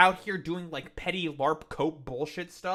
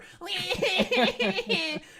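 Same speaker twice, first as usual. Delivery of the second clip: laughing; 11 semitones higher than usual. Real, not acted. Laughs at own parody.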